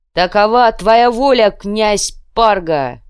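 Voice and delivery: loud, very drawn-out acclaim; crowd shout. A narrator's reading. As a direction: hail him loudly and slowly. Crowd confirms the prince.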